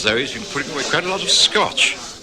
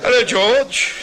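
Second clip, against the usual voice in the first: much higher, louder hail; hearty greeting; 6 dB louder, slower than usual. Acted. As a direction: call out across the noisy bar. Greeting man across bar.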